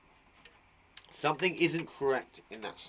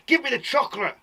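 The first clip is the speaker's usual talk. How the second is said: much higher, louder demand; exclaimed demand; 11 semitones higher, 7 dB louder than usual. Shown as a demonstration. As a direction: exclaim it like you want it now. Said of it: "You could have had an exclamation".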